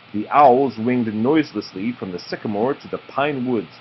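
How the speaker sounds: usual speaking voice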